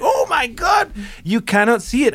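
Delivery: high-pitched retold outburst of disbelief; mock exclamation, shooting up high then easing; exasperated disbelief. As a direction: act out someone astonished you missed it. Retold exasperation at missing the actress.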